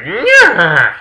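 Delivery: high, loud mock neigh; horse whinny. The horse panics in the retold story, loud mock whinny. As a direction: imitate a startled horse. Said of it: "the horse is like".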